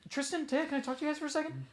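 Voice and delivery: a hint of an anime sidekick; mock character voice. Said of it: "They're like".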